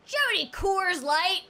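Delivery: slow, high American drawl; exaggerated American. American ordering in an English pub, exaggerated accent. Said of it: "into a pub and you'll be like".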